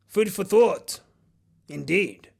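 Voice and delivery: normal talking voice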